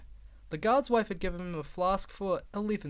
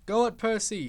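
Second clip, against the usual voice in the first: higher, louder yell; guard yelling encouragement to Percy; 4 semitones higher, 5 dB louder than usual. Acted. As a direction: cheer Percy on. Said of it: "he yelled".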